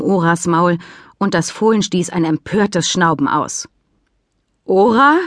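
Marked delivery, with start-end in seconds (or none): 4.6-5.2 surprised. Acted. Sam spots foal, grins.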